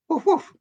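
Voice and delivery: very high, short woof woof; little dog's two barks. Retold or imitated. The little dog missing a woof, high short barks. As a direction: yap twice like a tiny dog. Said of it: "this little dog went".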